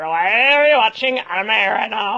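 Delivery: Lumpy Space Princess voice. Retold or imitated. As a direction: act out a bratty cartoon princess. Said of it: "You've got the best lumpy space princess voice".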